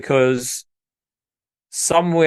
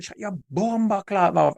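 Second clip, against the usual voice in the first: put-on slow, raised-pitch Jamaican-accented telling-off; imitated Jamaican woman; 5 semitones higher than usual. Jamaican woman silencing a dog over the fence, imitated accent. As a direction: stretch the words out in a Jamaican accent. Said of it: "it was a Jamaican woman".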